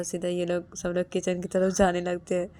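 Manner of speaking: ordinary delivery, nothing marked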